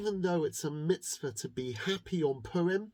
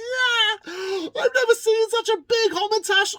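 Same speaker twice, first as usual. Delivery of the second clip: much higher, louder squealing cheer; ecstatic crowd; 10 dB louder than usual. Acted. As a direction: scream with delight at the huge treat. Crowd sees huge treat.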